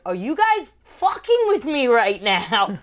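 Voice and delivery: loud, high, drawn-out disbelief; indignant, stretching it out loudly; exasperated outburst. Retold or imitated. Retold indignant outburst at guards.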